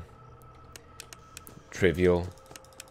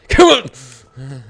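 sudden loud "come on"; loud, exasperated yelp; impatient outburst; 9 dB louder than usual. Real, not acted. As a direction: burst out urging it on. Bursts out at the stressful game.

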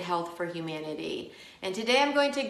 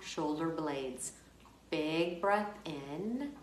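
slow, softer meditation voice with long pauses; guided meditation; 2 semitones lower, 7 dB quieter, slower than usual. Acted. Yoga teacher guiding listeners through a body scan, calm guided meditation. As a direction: guide them unhurried through each breath, drawing out "in" and easing off on "out".

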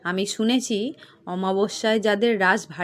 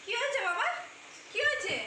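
high, anxious question; alarmed; 11 semitones higher, 11 dB quieter than usual. Acted. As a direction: answer a sudden call with worry. Answers father-in-law's sudden call. (উদ্বিগ্ন চড়া গলায়)

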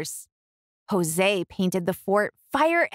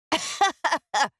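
high teasing laughter; mock howl of laughter; boys' mocking laugh; 8 semitones higher than usual. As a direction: laugh at her like the brothers. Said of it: "howled with laughter".

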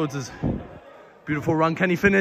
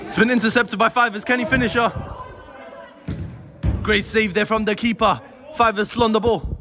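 usual speaking voice

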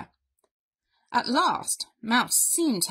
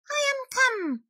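high, cheery little mouse voice; high-pitched storybook mouse; declaring mouse. A narrator's reading. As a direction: announce yourself brightly as a tiny mouse. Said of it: "declared Mouse".

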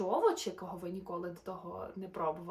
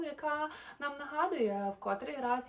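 plain reading voice

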